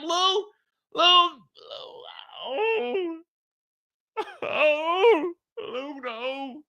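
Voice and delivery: high, desperate plea breaking into mock sobs; crying plea. Manager begging Lou, ending in high mock sobs.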